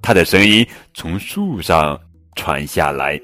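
ordinary delivery

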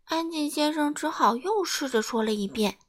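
usual speaking voice

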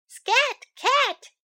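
high, chirpy bird voice shooing. Acted. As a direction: pipe "Scat Cat" like a little bird. Bird shoos the cat.

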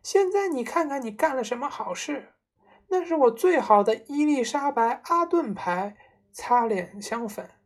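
high-pitched angry scolding; scolding shriek. A narrator's reading. Mother scolding father over her ruined face powder, high and angry. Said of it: "妈妈尖叫道".

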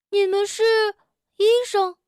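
high, loud confused question; puzzled. A narrator's reading. Frog misunderstanding the doctors, puzzled. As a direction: blurt out in confusion. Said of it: "跳跳一下子没听明白".

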